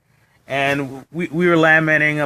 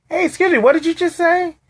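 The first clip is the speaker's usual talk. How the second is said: much higher retold challenge; pitched-up mock outrage at her remark; indignant confrontation; 13 semitones higher than usual. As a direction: call her out, stunned and offended.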